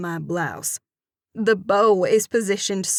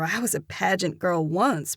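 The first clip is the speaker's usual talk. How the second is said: plain reading voice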